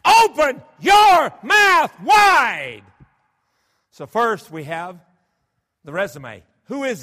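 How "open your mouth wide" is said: much higher and louder, very slow and drawn out; God's commanding call. Acted. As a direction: stretch every word like a divine command. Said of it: "When God says".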